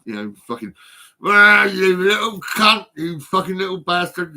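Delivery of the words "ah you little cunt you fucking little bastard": loud, slow drawn-out yell, fading; retold shouting. Shouting out in the section unit at the attacker, loud yell. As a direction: bellow the first words, then ease off. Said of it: "shouting out".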